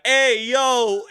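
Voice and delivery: loud, high toddler imitation; toddler's catchphrase yell. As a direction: burst in yelling like a toddler. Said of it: "Steven will come in and be like".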